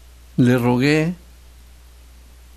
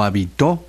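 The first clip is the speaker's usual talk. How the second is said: "habitó" stressed on the last syllable, a-bi-TÓ; 2 semitones lower than usual. Shown as a demonstration. Host teaching accent rules, "habitó" stressed on TÓ. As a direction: land hard on the TÓ of "habitó". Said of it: "con acento en la O".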